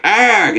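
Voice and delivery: high, short "arg" yell; hammed-up cry from a punch; mock pain cry. Punched Goblin cries out.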